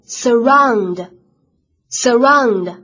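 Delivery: surround, said very slowly with the weight on ROUND; stress on the second syllable. Shown as a demonstration. Teacher models the word for students, stress on ROUND. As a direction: stretch surround out and land hard on ROUND. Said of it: "因为它的重音呢，是在后面".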